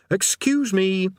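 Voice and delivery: high, drawn-out excuse me; polite but insistent interruption; interrupting. A narrator's reading. Rincewind trying to interrupt the demon.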